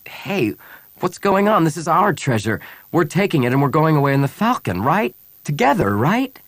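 high, anxious pleading questions; alarmed insistence. A narrator's reading. Han questioning Bria as rebels arrive, alarmed. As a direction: press her for reassurance, pitch climbing.

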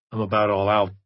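words pushed through clenched teeth; through gritted teeth. Acted. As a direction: jaw locked, barely opening. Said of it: "through gritted teeth".

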